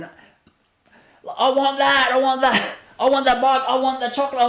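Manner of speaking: imitating a child screaming for a bike and chocolate; mock child's tantrum. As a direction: play a greedy kid wailing for things. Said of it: "When they start screaming".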